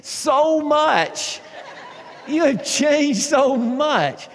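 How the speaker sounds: high, loud, slow, laughing all through; laughing tease. Retold or imitated. Friend laughingly telling him the truth. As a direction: stretch it out between laughs. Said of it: "then she laughed some more".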